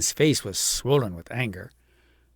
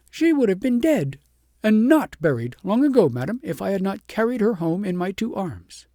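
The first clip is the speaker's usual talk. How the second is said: higher, louder, stung and defensive; indignant outburst; 7 semitones higher, 5 dB louder than usual. A narrator's reading. Shepherdess defends herself to the queen, loud and high. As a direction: she can bear no more. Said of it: "more than the shepherdess could bear".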